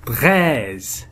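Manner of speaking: "treize" with a long open e; drawn-out vowel. Shown as a demonstration. Teacher presenting the first sound, "treize" with its vowel drawn out. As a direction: stretch the vowel in "treize" slowly. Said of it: "إذن الصوت الأول nous avons".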